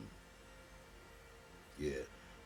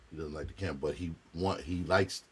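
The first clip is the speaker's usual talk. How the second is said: ordinary talk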